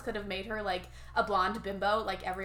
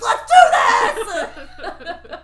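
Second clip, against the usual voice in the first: loud, high laughing shout; mock battle cry; 8 semitones higher, 17 dB louder, much slower than usual. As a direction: yell it like a fired-up rallying cry. Pregnant woman fired up for race.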